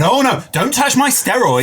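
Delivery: mildly hammed-up protest, rising high on the objection; mock indignation. Mimicking fans touchy about steroids, mock indignation. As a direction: play the precious offended fan.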